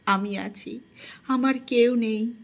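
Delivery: ordinary delivery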